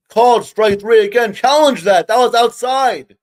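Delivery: high, loud, outraged protest at the umpire; furious outburst. Real, not acted. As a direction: yell at the bad call, really fed up. Umpire's bad third-strike call.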